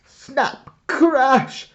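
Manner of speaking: high, sharp sound words; sudden crash. A narrator's reading. Narrator bursts in as the branch breaks, sharp and high. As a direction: burst out with the snap.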